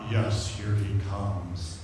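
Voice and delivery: low, slow, hushed medium; mock séance voice. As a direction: drop into slow, spooky soothsaying.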